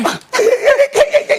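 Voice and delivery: high shouted laugh. Real, not acted. Laughs at co-host's jab.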